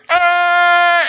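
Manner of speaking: high, loud mock yelp; retold scream. Retelling the garage scare, a loud yelp. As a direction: let out a startled yelp. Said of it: "and I hear".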